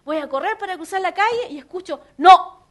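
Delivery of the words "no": sudden, very loud, higher-pitched no; loud command. Retold or imitated. Holy Spirit stopping her at the crossing, loud sharp command. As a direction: fire out one abrupt warning shout.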